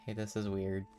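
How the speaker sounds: ordinary talk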